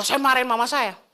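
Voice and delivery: ordinary talk, nothing marked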